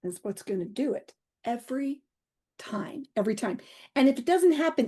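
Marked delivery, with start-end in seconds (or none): 1.4-3.6 whispered repeat. Real, not acted. Telling the host humor works, whispered. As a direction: breathe the words out. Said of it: "whispered emphasis".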